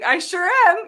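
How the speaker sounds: high, loud cheeky yes; cheeky enthusiastic. Retold or imitated. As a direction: chirp it back proudly. Cheeky reply retold.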